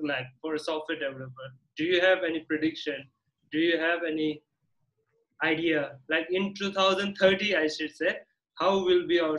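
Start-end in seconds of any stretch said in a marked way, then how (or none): none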